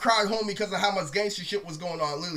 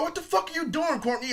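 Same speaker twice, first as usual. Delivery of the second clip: startled what-are-you-doing; squeaky shocked protest; shocked high cry; 8 semitones higher than usual. Retold or imitated. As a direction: pitch jumps up in disbelief. Reacting to Courtney's grope.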